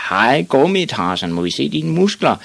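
high, loud taunting call; jeering shout. A narrator's reading. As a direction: taunt across a schoolyard, voice rising. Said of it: "stod de store drenge i skolegården og råbte".

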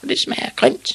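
spluttering, voiceless revulsion; disgusted. Acted. Ivan has swallowed the witch's soup, revolted. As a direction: spit the words out in disgust.

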